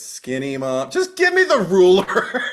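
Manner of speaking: rising high, loud plea; exasperated kid. Retold or imitated. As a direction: beg impatiently. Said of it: "I'd be like".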